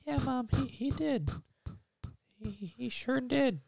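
put-on high little kid voice; little boy voice. Little Billy confessing to his dad in a high childish voice. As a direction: squeak it out like a guilty small boy.

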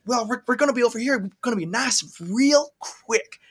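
Southern accent. Shown as a demonstration. Showing co-host how he does it, Southern accent. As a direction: stretch out the Southern words, drawing out "real quick". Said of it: "what I do when I do a Southern accent".

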